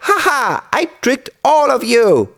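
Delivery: put-on high, teasing little-boy voice; playful high-pitched trickster boy; gleeful boy. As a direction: gloat in a high boy's voice with an acted ha-ha. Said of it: "he laughed with joy".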